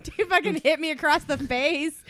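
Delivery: ordinary talk, nothing marked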